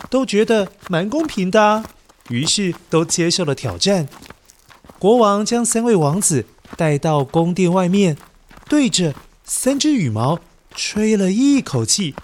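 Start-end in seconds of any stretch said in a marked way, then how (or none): none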